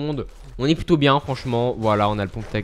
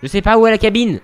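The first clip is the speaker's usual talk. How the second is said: higher, louder exasperated cry; frustrated outburst; 7 semitones higher, 8 dB louder than usual. Real, not acted. Streamer lost in Fortnite, venting to viewers, frustrated shout. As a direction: vent at yourself for being useless. Frustrated, can't find the cabin.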